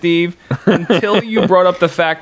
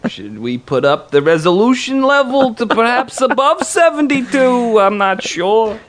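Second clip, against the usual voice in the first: mock nerd voice; 9 semitones higher than usual. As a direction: play a fussy tech geek. Mocking tech nerd voice.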